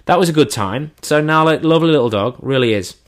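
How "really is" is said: ordinary delivery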